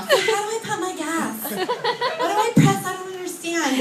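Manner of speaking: put-on high, helpless Valley girl whine. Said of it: "I feel like a Valley girl".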